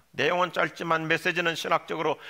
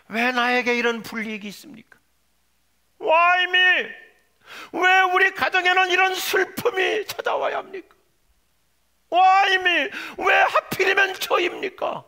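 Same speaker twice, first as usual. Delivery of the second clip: loud, high cry to God, building to a shout; anguished protest; 10 semitones higher, 10 dB louder than usual. Acted. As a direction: shout the complaint up at heaven. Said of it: "하나님께 이런 항변을 하기도 해요". (하나님께 울부짖듯 높이 외치며 항변)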